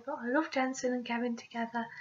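usual speaking voice

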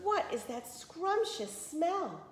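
high and slow, savoring the smell; hungry wolf. A narrator's reading. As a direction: lick your lips over every word. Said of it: "he whispered".

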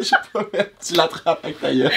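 laughing. Real, not acted. Laughing at the catch game.

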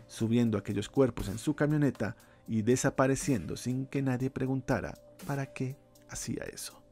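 ordinary delivery

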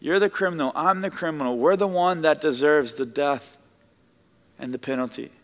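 normal talking voice

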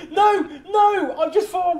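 high, loud burst of sudden inspiration; excited outburst. Real, not acted. Friend bursting in with a new idea, excited. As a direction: blurt it out, high and eager.

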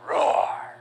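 rough, drawn-out roar; lion roar. Shown as a demonstration. Teacher showing children a lion, playful roar. Imitates lion just spelled.

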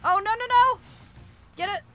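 high, loud string of no; panicked cries. Real, not acted. Gamer crying out in panic as the creature returns, high and loud. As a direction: shout in sudden panic.

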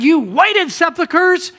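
slow, loud, high rebuke; preacher voicing Jesus condemning the Pharisees, loud and slow; Jesus rebuking. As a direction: thunder it out slowly at hypocrites. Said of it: "He said".

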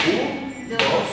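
evenly spaced two-beat count; marking the beat. Shown as a demonstration. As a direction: keep a steady count in two. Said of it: "vamos a marcar el compás".

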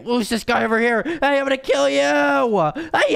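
normal talking voice

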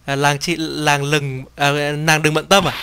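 ordinary talk, nothing marked

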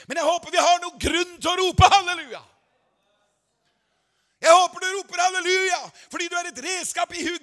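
building to a shouted climax; preaching at full force. Acted. Preacher rousing the congregation, building to a shout.

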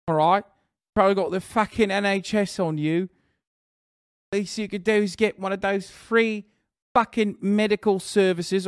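taunting the British chatter in a put-on accent; mock British accent. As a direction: needle him like a smug Brit. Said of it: "Do you think the B B C will invite you back".

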